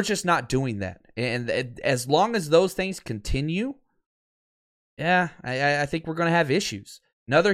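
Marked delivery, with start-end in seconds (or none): none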